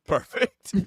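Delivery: laughing out the word. Real, not acted. Laughing at the punchline.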